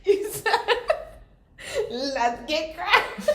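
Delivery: high, slow, teary laughing quote. Real, not acted. Mocking partner's slip while crying laughing. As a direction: choke out the quote through laughter. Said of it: "It's really fucking funny".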